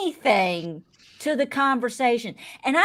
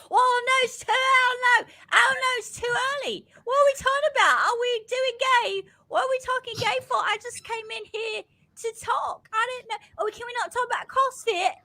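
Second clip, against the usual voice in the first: high-pitched Australian voice slipping into Cockney, as Bowie Jane; Bowie Jane impression, Australian accent; 9 semitones higher than usual. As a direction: mimic a flustered Australian houseguest, drifting into a London accent. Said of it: "you start off Australian, and then you get, like, Cockney".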